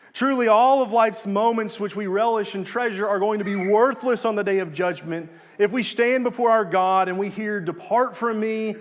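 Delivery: emphatic preacher warning of judgment; forceful preaching. Real, not acted. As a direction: press each phrase home with urgency.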